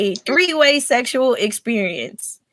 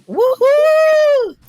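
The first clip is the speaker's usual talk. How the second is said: excited cheer. Real, not acted. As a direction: cheer the crowd on. Host cheering the guest's entrance.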